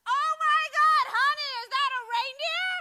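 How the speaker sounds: hammed-up over-excited American yelling; loud American tourist. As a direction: shriek with wonder at the reindeer. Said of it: "this immediately becomes".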